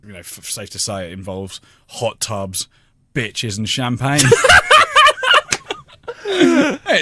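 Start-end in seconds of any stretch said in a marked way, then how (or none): 4.2-7.0 laughing. Real, not acted. Laughing at own joke.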